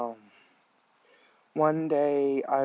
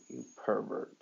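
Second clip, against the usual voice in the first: pouting; 4 semitones lower, 8 dB quieter than usual. A narrator's reading.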